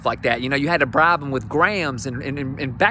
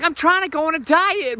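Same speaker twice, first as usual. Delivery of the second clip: squeaky high wife voice; falsetto wife; 12 semitones higher than usual. Retold or imitated. Comic voicing the wife's excuse in a squeaky high voice. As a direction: chirp it like the wife.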